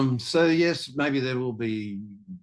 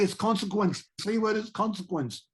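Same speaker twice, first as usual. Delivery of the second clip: much higher, insistent retold self; mock exasperated explaining; 10 semitones higher than usual. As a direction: pitch it up as if explaining to shocked strangers. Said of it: "I'm going".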